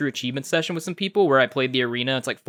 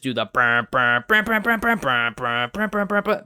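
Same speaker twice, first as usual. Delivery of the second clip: imitating [name] brr. Imitating [name]'s signature ad-lib.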